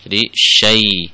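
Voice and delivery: syai with a clear sh sound, drawn out; correct syai. Shown as a demonstration. Quran teacher shows the correct syai, slowly. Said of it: "jangan sampai mencampur suara".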